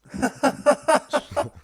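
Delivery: high, loud burst of laughter; big, high real laugh. Real, not acted. Reacting to the demon talk.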